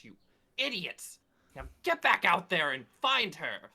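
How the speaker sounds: high-pitched exasperated order; angry command. Acted. Esther sends idiots back to search, angry. Acted noble orders underlings.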